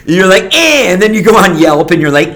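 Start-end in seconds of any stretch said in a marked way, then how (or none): 0.6-0.9 high, loud dismissive eh; mock unimpressed grunt. Diner shrugging off a meal, high dismissive eh. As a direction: toss off a bored grunt.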